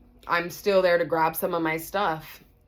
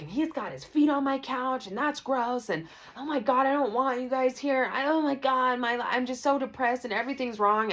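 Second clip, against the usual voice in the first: higher voice, overwrought distress; imitating upset Nancy; 8 semitones higher than usual. Roommate mimicked melting down about the couch, high distressed voice. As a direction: ham up her meltdown, pitched up high. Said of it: "And she's like".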